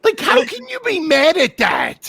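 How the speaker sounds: high-pitched cry of disbelief; incredulous shout. Real, not acted. Comic baffled by man angry at a joke, incredulous.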